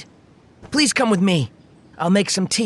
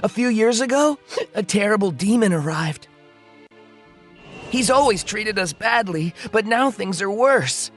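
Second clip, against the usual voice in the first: understated tearful account; tearful villager. Acted. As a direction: tell it with a touch of grief, breaking down at the end. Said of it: "through his tears".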